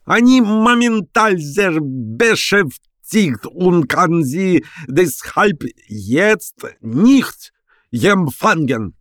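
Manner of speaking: slow, high-pitched irate refusal; exasperated broken German. A narrator's reading. Professor refusing a German caller, slow and exasperated. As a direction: labour through German in high agitation.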